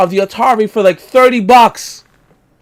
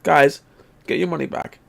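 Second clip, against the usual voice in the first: ordinary talk, nothing marked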